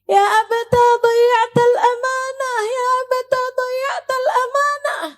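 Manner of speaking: high, loud tearful plea; ratapan anak. Retold or imitated. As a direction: wail it out as a pleading child. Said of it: "Dia bilang". (ratapan anak memohon)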